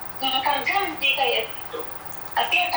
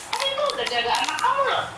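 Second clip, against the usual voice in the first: fast, much higher, heated complaint; retold angry outburst; 10 semitones higher, faster than usual. Maid replaying her heated complaint to the employer, fast and high. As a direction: fire it off fast in indignation.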